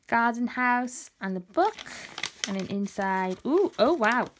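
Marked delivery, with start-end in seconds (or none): 3.4-4.3 delighted wow. Real, not acted. Opening gift.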